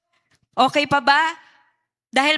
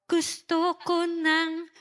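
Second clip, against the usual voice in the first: weary mock quitter; 6 dB quieter, much slower than usual. Voicing a quitter.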